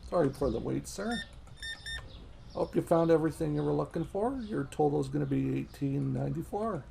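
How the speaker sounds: a hint of a cheerful shop clerk serving customers; polite cashier. Plays a polite game cashier.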